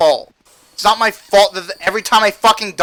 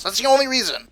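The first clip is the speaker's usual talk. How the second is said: ordinary delivery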